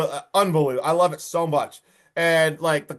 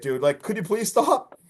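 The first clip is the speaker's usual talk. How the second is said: usual speaking voice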